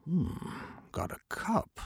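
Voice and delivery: scheming whisper; whispered aside. Acted. Merchant muttering to himself.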